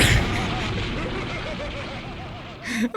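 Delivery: squealing laugh; high burst of laughter. Real, not acted. Cracks up at the clip.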